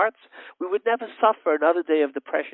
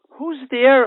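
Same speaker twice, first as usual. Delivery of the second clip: high, louder frightened call; startled mother calling from upstairs, scared; 10 semitones higher, 8 dB louder than usual. Acted. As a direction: call out into the dark, afraid. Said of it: "A scared voice".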